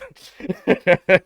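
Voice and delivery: high, loud burst of laughter; a real cackle; gleeful laugh. Real, not acted. Laughing at dodging tax.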